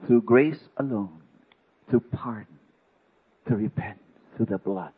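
a heartfelt pulpit prayer; fervent prayer. Real, not acted. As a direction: pray aloud before the congregation. Preacher turns to prayer.

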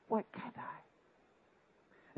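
very slow, breathy whisper, near tears; a pleading, broken whisper to God; whispered sob. Retold or imitated. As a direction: let the question break into a whisper. Weeping alone on the bed.

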